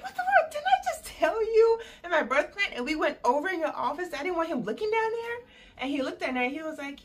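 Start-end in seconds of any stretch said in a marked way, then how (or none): 0.0-4.1 very high, loud, outraged yell; furious outburst. Retold or imitated. Wife in labor yelling at doctor over birth plan, high furious. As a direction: blow up at him in disbelief.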